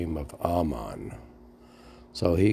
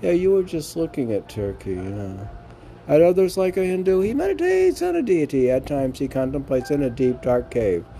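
raised, lilting verse reading; poem recital; 7 semitones higher, 5 dB louder than usual. Acted. Reading the guru poem aloud for the podcast, lilting recital. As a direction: read the devotional verses with lift.

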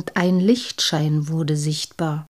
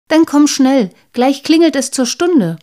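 louder and higher, urging her friend along; calling out; 6 semitones higher, 6 dB louder than usual. Acted. Polly urging Pia to the classroom, calling out louder. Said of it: "rief Polly".

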